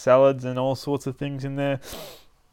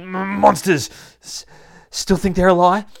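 loud, high stuttering cry; stammer, then shout it out; high-pitched monster alert; stammered alarm; 8 semitones higher, 6 dB louder than usual. Acted. Monsters charge in on Tristan.

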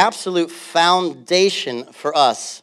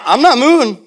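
high, loud mock stubborn voice; imitating a stubborn driver; mock stubborn refusal; 9 semitones higher, 7 dB louder than usual. Pastor mimics a driver refusing to move, high and loud. Retold stubborn guy in car.

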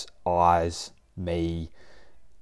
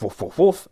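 higher and louder woofing like an eager dog; excited barking; 7 semitones higher, 6 dB louder than usual. Retold or imitated. Dog barking at owner coming home, loud excited woofs. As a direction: bark it out like the dog demanding pats.

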